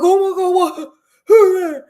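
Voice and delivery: high, loud disbelieving outburst; shocked. Retold or imitated. Stanley reacting in disbelief, a loud high shocked cry.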